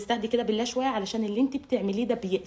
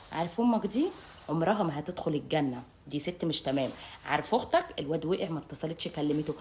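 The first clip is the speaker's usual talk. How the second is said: lower, softer sneering gossip; hushed badmouthing; 6 semitones lower, 6 dB quieter than usual. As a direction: badmouth his family in a low voice. Quoting wife badmouthing in-laws. (همس ساخر عن أهل الزوج)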